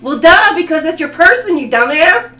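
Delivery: high, loud teasing rant; mock exasperation. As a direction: scold them like a frustrated friend. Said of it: "you're gonna be like".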